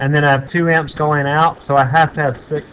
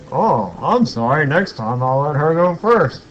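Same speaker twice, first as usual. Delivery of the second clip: hammed-up drunk apology, pitch swooping up and down; imitated drunk man; 4 dB louder than usual. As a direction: play a tipsy man apologizing in big swoops. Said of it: "he'd been drinking a lot".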